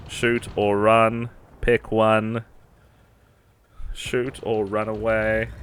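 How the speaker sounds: slow, stretched-out commands; slow drawn-out urging. Real, not acted. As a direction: drag out each word.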